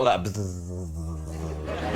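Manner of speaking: a low, soft "bzz" imitating a bee; bee buzz. Shown as a demonstration. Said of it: "Do it".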